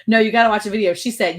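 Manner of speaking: normal talking voice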